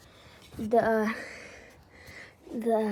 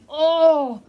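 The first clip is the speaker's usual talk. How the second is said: usual speaking voice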